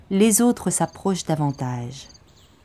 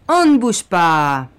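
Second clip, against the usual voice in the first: tough thug; 5 dB louder than usual. Acted. Thug holds Nicolas.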